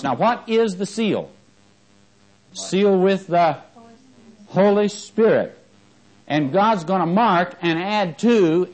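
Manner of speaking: high, loud, slow, driving preacher cadence; preaching at full force. Acted. Preacher driving home the seal of the Holy Spirit, fervent. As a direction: preach it to the back row.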